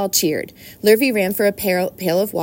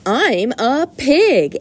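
high, loud, drawn-out boast; crying out; 6 semitones higher, 5 dB louder, much slower than usual. A narrator's reading. Boy tosses straw in the air, crying out. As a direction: stretch it out in a slow, high yell. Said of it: "Avery cried".

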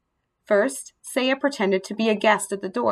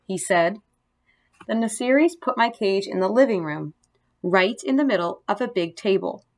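usual speaking voice

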